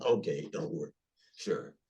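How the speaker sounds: low, soft, kindly reassurance; lightly imitating the guru's deep calm voice; a hint of an elderly teacher's low murmur; voicing Prabhupada.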